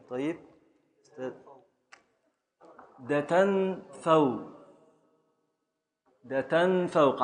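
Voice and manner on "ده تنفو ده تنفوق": slow scansion. Shown as a demonstration. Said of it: "على وزن".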